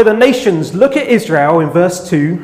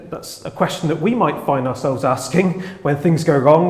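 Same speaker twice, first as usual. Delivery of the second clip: ordinary talk, nothing marked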